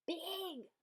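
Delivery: high squeaky squeal. A narrator's reading. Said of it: "little Gloom squealed".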